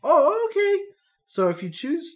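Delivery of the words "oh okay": put-on high, loud little-girl okay; girl agreeing. Stacy agreeing to the plan, high girlish voice. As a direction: give in brightly like a child.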